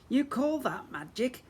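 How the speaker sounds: high, slow taunting sneer; fairy mocking goblin wizards. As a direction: jeer over the wind. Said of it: "Dentina laughed over the tornado".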